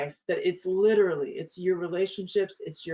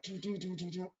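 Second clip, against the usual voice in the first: mock walking tune; 13 dB quieter than usual. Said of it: "He comes like".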